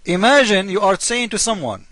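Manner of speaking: ordinary delivery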